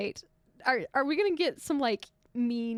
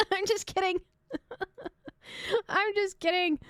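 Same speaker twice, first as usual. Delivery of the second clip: laughing retraction; 7 semitones higher than usual. Real, not acted. Vlogger takes back the joke to Canadian viewers, laughing. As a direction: blurt it through laughter.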